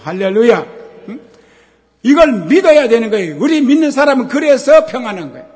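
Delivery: loud, high psalm proclamation; shouted praise and scripture; full-force preaching. Acted. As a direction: lift the congregation with the verse. Preacher quotes psalm, hallelujah.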